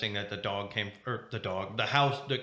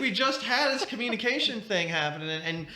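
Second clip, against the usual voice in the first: hammed-up betrayal, pitched way up; mock outrage; 11 semitones higher than usual.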